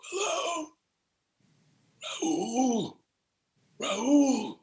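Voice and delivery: a sick man straining to be heard on the line; frail father on the phone. Retold or imitated. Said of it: "My dad took the phone".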